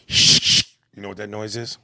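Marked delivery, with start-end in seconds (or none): 0.1-0.6 loud shush. Acted. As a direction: hiss a shush.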